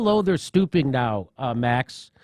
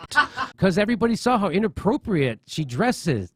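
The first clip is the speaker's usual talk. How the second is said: ordinary talk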